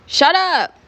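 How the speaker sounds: exasperated acted outburst; annoyed interruption. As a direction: cut them off, pitch jumping up. Classmate interrupts the spoiler.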